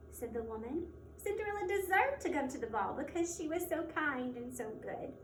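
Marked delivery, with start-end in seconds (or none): none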